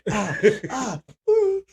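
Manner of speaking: high squealing laughter; laughing shriek. Real, not acted. Cries out laughing.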